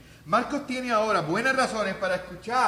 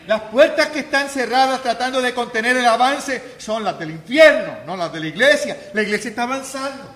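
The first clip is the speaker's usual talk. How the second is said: preaching at full force; 3 semitones higher, 8 dB louder than usual. Acted. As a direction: thunder it from the pulpit. (predicación a todo pulmón)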